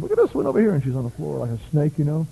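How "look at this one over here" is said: wife's exclaim. Retold or imitated. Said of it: "Dolly looks over and goes".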